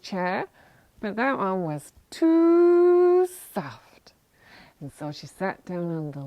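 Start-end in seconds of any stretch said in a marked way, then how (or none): 2.1-4.1 storyteller's long high "too"; drawn-out emphasis. A narrator's reading. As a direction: stretch TOO way out on a high note. Storyteller stretching the verdict.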